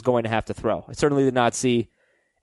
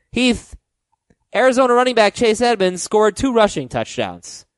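ordinary talk

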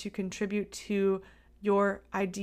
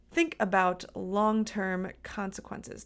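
normal talking voice

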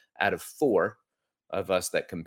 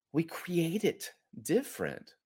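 slower, softer echo of the line; softer repeat; 7 semitones higher, 5 dB quieter, slower than usual. Real, not acted. To listeners, repeating the point softer and slower. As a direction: let the repeat sink in quietly.

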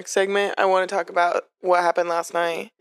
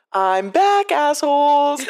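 higher-pitched, playful call-out; mock brash announcement; 8 semitones higher, 4 dB louder, much slower than usual. Server greets her returning table, mock brash announcement.

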